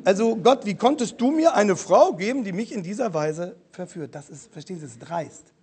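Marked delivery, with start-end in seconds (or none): none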